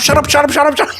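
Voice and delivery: loud, high, rapid-fire repeats; frantic hushing. Retold or imitated. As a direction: imitate a friend frantically cutting in. Friend cuts him off, retold.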